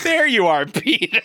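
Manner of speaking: high, loud child's voice imitation; kid from Hook. Quoting the kid in Hook to co-hosts, high mock-child voice. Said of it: "the kid in Hook stretches his cheeks out and goes".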